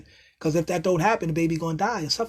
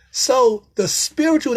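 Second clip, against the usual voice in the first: slow, high, louder build; awed; 5 semitones higher, 6 dB louder, slower than usual. Real, not acted. Still buzzing from his insight, slow and awed. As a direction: savor the words in awe.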